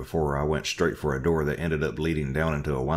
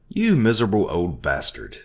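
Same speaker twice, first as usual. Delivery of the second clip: louder, higher angry curse; cursing; 5 semitones higher, 5 dB louder than usual. Acted. Narrator cursing at Orville, angry. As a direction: spit the insult at him. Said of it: "I cursed at Orville".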